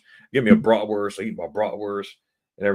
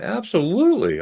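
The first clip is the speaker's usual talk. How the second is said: eager yes; 11 semitones higher, 4 dB louder than usual. Retold or imitated. Eager answer to couple.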